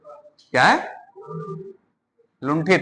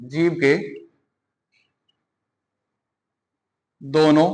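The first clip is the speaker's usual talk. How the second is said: softer, word by word with long pauses; slow spelled-out explanation; 5 semitones lower, 7 dB quieter, slower than usual. Shown as a demonstration. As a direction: spell out each word slowly for the class. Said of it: "आ, जी". (धीमे, एक-एक शब्द रुककर समझाएँ)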